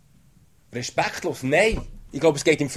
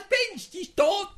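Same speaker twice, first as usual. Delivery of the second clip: high, slow jeering through laughter; mocking laugh; slower than usual. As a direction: laugh at him scornfully. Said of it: "haben ausgelacht".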